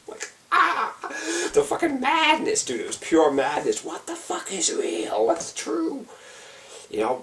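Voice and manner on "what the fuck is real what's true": much higher, slow, dazed; slow high-pitched disbelief; bewildered high wail. Retold or imitated. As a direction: stretch it out high like the mind is cracking. Reliving the madness.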